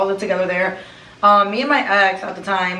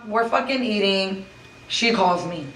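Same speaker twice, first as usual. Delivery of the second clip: usual speaking voice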